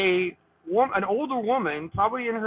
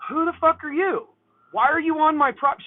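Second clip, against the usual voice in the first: stern, higher-pitched retort; 5 semitones higher, 4 dB louder than usual. Retold or imitated. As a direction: confront an intruder on your property, raised pitch. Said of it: "very, very sternly".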